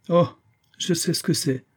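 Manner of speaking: lightly acted scorn, pitched high; disdainful. A narrator's reading. Jack dismissing his brother's discovery, disdainful. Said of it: "dit dédaigneusement Jack".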